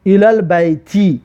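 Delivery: slow, with البیت ending in a kasra, ilal-bayti; teacher modelling الی البیت; slow Arabic demo. Shown as a demonstration. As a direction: read الی البیت slowly for the class. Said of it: "آخرش کسره است".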